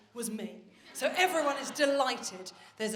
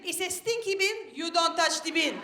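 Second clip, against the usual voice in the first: put-on toddler's Italian accent; 4 semitones higher, 3 dB louder than usual. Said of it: "she sounded Italian".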